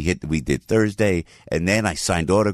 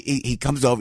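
usual speaking voice

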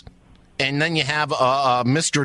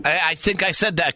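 normal talking voice

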